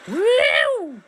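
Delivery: high loud whoop; cheering woo. Real, not acted. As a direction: whoop with joy. Minecart ride cheer.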